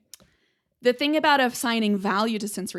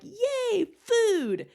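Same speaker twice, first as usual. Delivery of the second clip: much higher, a gleeful little cheer; excited cheer; 14 semitones higher, 3 dB louder than usual. Pretend. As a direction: cheer for food like an overjoyed pup. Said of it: "you get that emotional, like".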